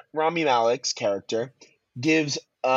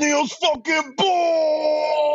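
big booming reveal, slow; triumphant shout; 13 semitones higher, much slower than usual. Retold or imitated. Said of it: "they go".

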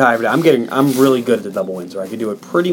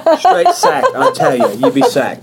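put-on Cockney accent; 14 semitones higher, 4 dB louder than usual. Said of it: "talking in a Cockney accent".

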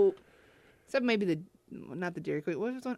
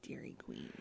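whispering; 22 dB quieter than usual. Acted. Repeats pun in whisper.

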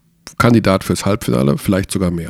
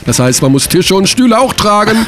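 hammed-up ad read; 8 semitones higher than usual. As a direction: sell the job ad like a commercial. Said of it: "Kannst du das ein bisschen mit mehr Werf vorlesen".

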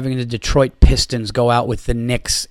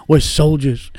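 mock soldier speech; 3 semitones higher, slower than usual. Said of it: "The Baltimore Ravens and Ray Lewis".

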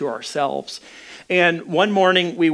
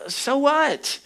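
high-pitched dismissive retort; 10 semitones higher than usual. Retold or imitated. Husband answering his wife mid-fight, acted dismissive. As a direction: toss it back, pitched up.

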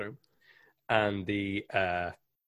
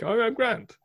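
"Oh, I'm grand" tossed off much higher; breezy "I'm grand"; 13 semitones higher, slower than usual. Retold or imitated. Irish patient waving off the blood, higher breezy voice. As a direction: brush off a gushing wound lightly.

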